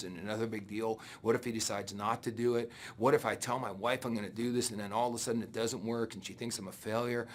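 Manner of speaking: imitating a nervous newcomer second-guessing; anxious worry. Newcomer wakes up doubting the business, anxious quiet voice. Waking up next morning full of doubt.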